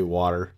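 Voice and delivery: WATER with the T softened to a D; casual water. Shown as a demonstration. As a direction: say WATER the everyday way, "wah-der". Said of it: "I also just kinda say".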